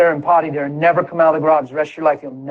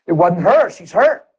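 ordinary talk, nothing marked

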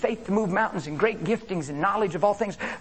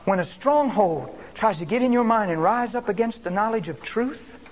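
usual speaking voice

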